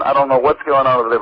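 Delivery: ordinary talk, nothing marked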